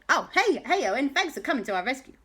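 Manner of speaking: high, quick, grateful welcome; delighted greeting. Acted. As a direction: greet a rescuer chirpily. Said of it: "And they went".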